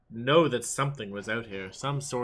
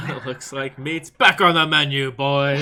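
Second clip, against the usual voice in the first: hammed-up shout building to the end; rallying cry; 3 semitones higher, 9 dB louder than usual. Player voicing the riled-up goblins, hammed-up rallying cry. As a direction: crow it louder and louder to the gang. Acts goblins celebrating over steak.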